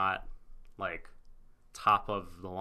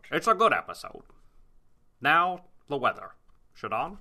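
put-on loud, higher Scottish-accented anchor; Sean Connery newscaster; 6 semitones higher, 6 dB louder than usual. Anchor throwing to the weather, Scottish accent. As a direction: hand over to the weather, Scottish news style. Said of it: "sounding more and more like Sean Connery".

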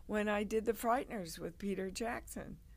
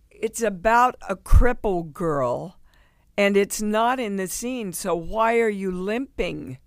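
much louder, pointed demand; teacher's loud challenge; 13 dB louder than usual. Retold or imitated. Acting teacher confronting Dee about the limp, loud accusing challenge. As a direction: call her out loudly in front of the class.

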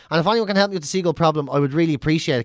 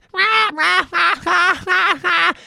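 high, squawking seagull cackle; mock seagull laughter; 11 semitones higher, 3 dB louder than usual. Seagulls answering the shout, imitated mocking laughter. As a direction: cackle back like a flock of gulls. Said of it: "hear loads of them laughing back".

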